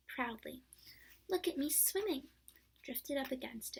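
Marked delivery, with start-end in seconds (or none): 1.3-2.3 much higher, louder little-kangaroo squeak; squeaky Roo. Acted. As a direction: squeak it out proudly. Roo boasting.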